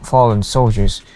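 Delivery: ordinary talk, nothing marked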